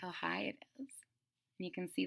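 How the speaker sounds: soft awed whisper. Acted. Storyteller marvelling at the kite to children, hushed whisper. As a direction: breathe it in wonder.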